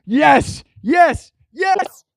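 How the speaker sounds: climbing cheer of triumph; rising yes. Real, not acted.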